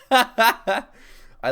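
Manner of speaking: slow, laughing through the words; a slow laugh over mock pride. Real, not acted. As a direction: chuckle as you say you love it. Laughing at own podcast joke.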